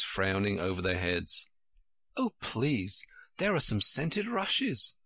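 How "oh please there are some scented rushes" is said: put-on high, delighted girlish cry; an excited child's voice, pitched up; sudden delight. A narrator's reading. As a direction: burst out in pleading delight. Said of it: "cried in a sudden transport of delight".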